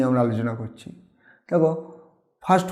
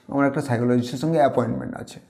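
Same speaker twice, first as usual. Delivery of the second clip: usual speaking voice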